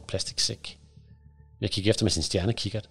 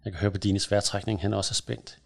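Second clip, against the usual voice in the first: plain reading voice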